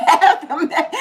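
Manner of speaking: normal talking voice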